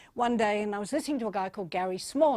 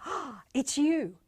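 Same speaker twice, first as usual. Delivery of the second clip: slow gasp of mock rapture; joking adoration; 3 semitones higher, slower than usual. Greeting a partner on date night, gasping mock delight. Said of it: "Even if you do it as a joke".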